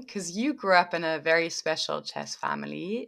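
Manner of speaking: ordinary delivery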